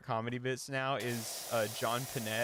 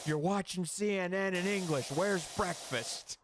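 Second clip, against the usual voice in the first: higher, louder exasperated yelling; retold angry yell; 8 semitones higher, 3 dB louder than usual. Comedian yelling at the hotel concierge, much higher and louder. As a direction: blow up at the snooty clerk. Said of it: "He's like".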